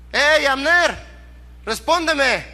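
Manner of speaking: high, drawn-out call across a distance; calling out shout. Acted. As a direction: call out to a man far below. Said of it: "empezó a gritar". (grito de llamada a lo lejos)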